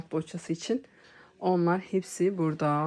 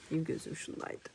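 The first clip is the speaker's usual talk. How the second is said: whispering; 8 dB quieter than usual. Real, not acted. Whispering while browsing.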